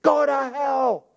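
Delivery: high, drawn-out mock curse; retold insult. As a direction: imitate someone hurling a curse, high and stretched. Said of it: "when a person tells me".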